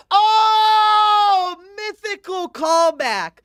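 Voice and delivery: loud, high, gleeful outburst; excited shout. Real, not acted. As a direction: yell it as the memory hits. Suddenly remembers a joke.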